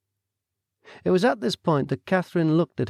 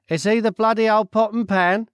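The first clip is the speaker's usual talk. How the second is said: brash Cockney man, higher and louder; nosy Cockney stranger butting in; loud, cheeky Cockney voice; Cockney accent; 10 semitones higher, 6 dB louder than usual. Acted. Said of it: "asked the man".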